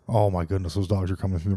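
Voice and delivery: normal talking voice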